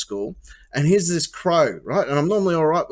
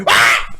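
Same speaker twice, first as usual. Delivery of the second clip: crows' squawk; 7 dB louder than usual. Retold or imitated. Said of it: "they both go".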